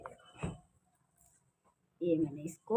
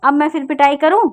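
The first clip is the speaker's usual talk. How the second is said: mock threat; 2 semitones higher, 7 dB louder than usual. Real, not acted. Mother threatens child.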